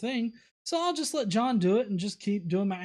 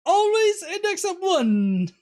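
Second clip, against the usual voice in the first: much higher, louder and slow goofy put-on voice; silly voice; 12 semitones higher, 7 dB louder, slower than usual. Host reads a viewer's silly line in a high, slow put-on voice. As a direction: say it like a goofy cartoon. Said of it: "make him say really silly stuff like".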